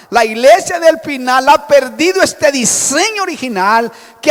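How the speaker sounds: loud, high, driving preaching; full-force preaching. Acted. Preacher exalting the early church to his congregation, loud and fervent.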